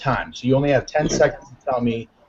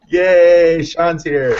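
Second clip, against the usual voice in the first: loud, higher-pitched welcoming cheer; excited cheer; 4 semitones higher, 5 dB louder, much slower than usual. Real, not acted. Host greeting a guest who just turned up, excited. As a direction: cheer the arrival with a big yay.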